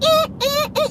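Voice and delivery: high, repeated mock stabbing noise; short squeaked horror sting; horror-movie stabs. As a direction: imitate slasher music with short eh's. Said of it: "he be screaming like Jason is behind him".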